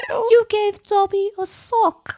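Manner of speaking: high, loud, slow house-elf squeal; Dobby. Acted. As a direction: play an elf in a hammed-up British voice. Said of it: "You sound like Dobby from Harry Potter".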